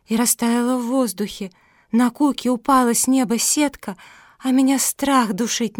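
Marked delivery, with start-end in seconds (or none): none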